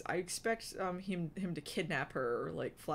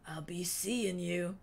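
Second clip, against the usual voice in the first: slow, drawn-out goodbye of a drifter; slow farewell; slower than usual. A narrator's reading. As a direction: let it linger. Wingtip's held voice.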